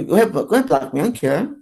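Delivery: ordinary talk, nothing marked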